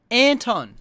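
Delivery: frustrated fan bellowing a name; exasperated shout. Acted. Ranting at fighter's poor showing.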